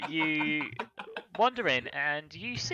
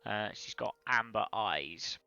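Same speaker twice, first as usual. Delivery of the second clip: normal talking voice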